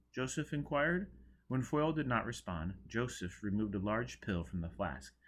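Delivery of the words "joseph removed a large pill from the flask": plain reading voice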